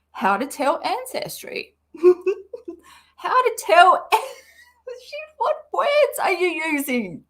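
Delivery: high, cracking laugh through the words; laughing fit. Real, not acted. Reading the post aloud and breaking into high laughter. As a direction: lose it laughing mid-question.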